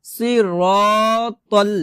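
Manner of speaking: slow "সিরাতাল" with rounded, long raw; recited "সিরাতাল" with heavy sad and ra. Shown as a demonstration. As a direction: stretch the ra of "সিরাতাল", thick and dark. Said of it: "সি র হবে".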